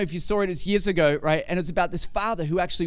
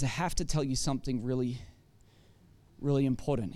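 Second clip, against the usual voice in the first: solemn hushed; 7 semitones lower, 7 dB quieter, faster than usual. Retold or imitated. Rescuer breaks news to father.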